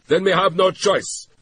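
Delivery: loud, high final threat; shouted threat. A narrator's reading. Tagomi threatens the SD office, shouting. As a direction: end the call with menace. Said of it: "Mister Tagomi shouted".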